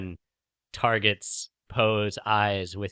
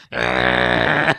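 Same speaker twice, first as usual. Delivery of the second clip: sharp low yelp of surprise; startled yelp; 12 semitones lower, 6 dB louder than usual. Acted. Rey yelping as the claw hooks her leg. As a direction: yelp as the claw grabs you.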